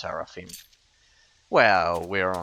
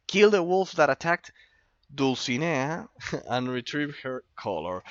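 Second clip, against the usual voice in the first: reading quest aloud; 5 semitones higher, 3 dB louder than usual. Pretend. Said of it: "I'll have to do all the reading myself".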